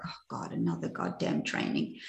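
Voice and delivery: low, whispery grumble to herself; hushed, weary inner complaint, acted out; muttered aside. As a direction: grumble it under your breath. Her private reaction, retold.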